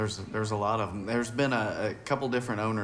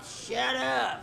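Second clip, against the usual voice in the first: mock snapped shut up, much higher; annoyed bar patron; 14 semitones higher than usual. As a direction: play the fed-up regulars. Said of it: "and they're like".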